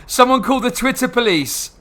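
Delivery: high, loud, slow hammed-up alarm; mock panic. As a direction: ham up a panicked call for police. Sarcastic mock panic about Twitter.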